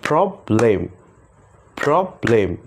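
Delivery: প্রবলেম split into two beats, prob-lem; clapping syllables. Shown as a demonstration. Teacher claps syllables for students, two beats. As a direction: clap twice: প্রবলেম. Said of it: "আমরা তালির মাধ্যমে সেই ওয়ার্ডগুলোকে শিখব".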